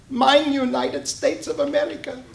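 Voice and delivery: put-on a grandfather's Russian accent, defending his country. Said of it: "in his thick Russian accent".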